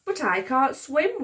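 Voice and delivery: ordinary delivery, nothing marked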